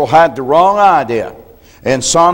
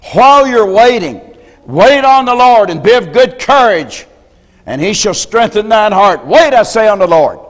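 raised, higher, louder scripture proclaiming; preaching fervor; 5 semitones higher, 4 dB louder than usual. Acted. Preacher exhorting the church to wait on the Lord, loud fervor. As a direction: proclaim it to the congregation. Preacher quoting Psalm at full force.

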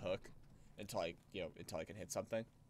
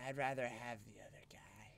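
whispering. Real, not acted. Stealth gameplay aside.

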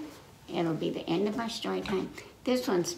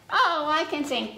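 cheerful chicken; 6 semitones higher, 4 dB louder than usual. Acted. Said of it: "Chicken said".

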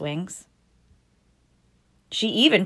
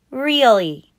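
Trixie answering; 5 semitones higher, 6 dB louder than usual. Acted. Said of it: "said Trixie".

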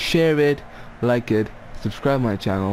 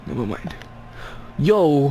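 normal talking voice

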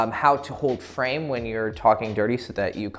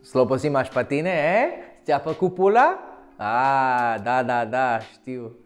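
playful Romanian trash talk; rough, teasing Romanian banter; dirty talk in Romanian. Acted. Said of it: "I just did some dirty talk in Romanian".

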